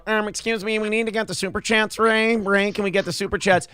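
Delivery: Producer Joe. Retold or imitated. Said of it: "his voice is a little higher".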